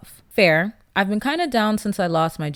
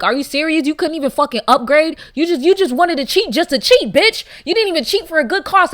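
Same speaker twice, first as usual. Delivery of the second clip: higher, louder, incredulous telling off; mock outrage at a cheater; 6 semitones higher, 6 dB louder than usual. Imagined rant at a partner who cheated down, hammed-up outrage. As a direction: let the disbelief climb as you scold. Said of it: "I'd be like".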